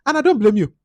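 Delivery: ordinary talk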